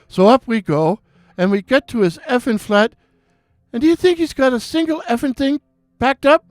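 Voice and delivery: put-on high, excited Irish accent, ranting; an Irish barman venting about his day on the phone; Irish bartender impression. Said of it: "beautiful restful Irish voice".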